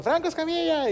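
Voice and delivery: high-pitched excited recognition; starstruck fan. Retold or imitated. Store clerk spots the famous comedian, starstruck. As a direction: call out a celebrity's name, high.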